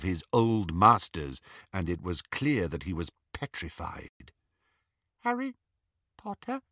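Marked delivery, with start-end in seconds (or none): 5.2-6.6 high, soft squeaky elf voice; house elf. Acted. Petrified Dobby greets Harry, high soft elf voice. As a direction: speak like a terrified elf.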